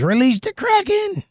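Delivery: high, slow call that cracks; real voice crack on a drawn-out, soaring cry. Acted. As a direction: strain far up high until the voice breaks. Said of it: "My voice is cracking".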